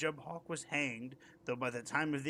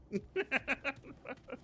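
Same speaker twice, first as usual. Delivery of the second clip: laughing; 11 semitones higher than usual. Real, not acted. Reader laughs after story ends.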